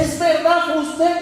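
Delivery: high, loud amazed question; disbelief. Acted. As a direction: ask it as if you can't believe your ears. Man cannot believe it. (incredulidad aguda y fuerte)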